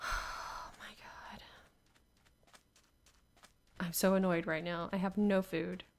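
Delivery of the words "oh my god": exasperated whisper. Real, not acted. Exasperated sigh.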